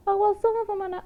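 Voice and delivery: high-pitched mocking imitation; mimicking naysayers. Mimicking people who excuse spiders.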